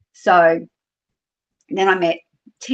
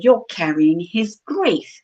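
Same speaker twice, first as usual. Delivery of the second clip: slow, higher-pitched pronouncement; imitated dawning revelation; 4 semitones higher, slower than usual. Tarot reader to grieving man's partner, slow revelation. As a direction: draw it out as a retold realisation.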